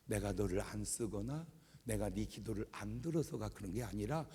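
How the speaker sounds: soft, low voice of God; God answering. Retold or imitated. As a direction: answer calmly, slowing for the punchline. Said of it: "하나님이 금방 말씀해 주시는 거예요".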